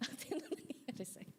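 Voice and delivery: hushed, worried whisper; soft whispered concern; whispered question. Retold or imitated. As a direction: whisper it as the sister peeking in. Sister's question retold again.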